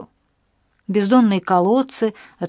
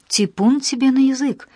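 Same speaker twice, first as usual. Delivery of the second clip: frightened; 6 semitones higher than usual. A narrator's reading. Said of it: "испугалась Настя".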